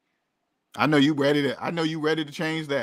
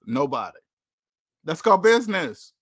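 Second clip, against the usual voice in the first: usual speaking voice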